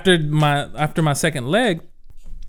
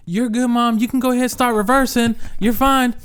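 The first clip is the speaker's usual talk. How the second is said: calling out; 8 semitones higher than usual. Retold or imitated. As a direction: shout it reassuringly across the yard. Calling out to mom reversing van.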